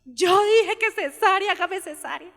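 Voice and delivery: high, slow tearful plea; crying plea. Retold or imitated. The crying daughter pleads with the doctor, high and slow. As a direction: plead through tears for the cesarean. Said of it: "ay, lloraba".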